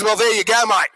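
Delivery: busy Aussie waiter; matter-of-fact broad Australian twang; broad Australian accent. Acted. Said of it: "very broad Australian accent".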